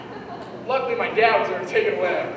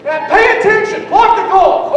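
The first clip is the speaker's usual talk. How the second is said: 1 coach yell; 8 semitones higher, 8 dB louder than usual. Retold or imitated. Coach yelling at soccer kid.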